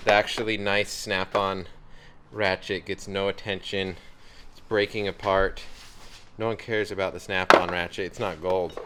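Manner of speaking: ordinary talk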